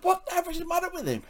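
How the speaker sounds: very high, quick question; high-pitched, baffled demand; retold bafflement. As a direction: blurt it out fast in a high voice. Retold baffled question amid laughter.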